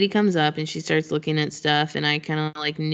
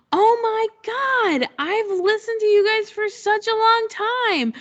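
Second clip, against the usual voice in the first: starstruck fan; 14 semitones higher, 3 dB louder than usual. Retold or imitated. Fan gushes to the podcast host, starstruck and excited. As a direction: squeal like you met your idol.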